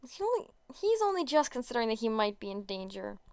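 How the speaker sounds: usual speaking voice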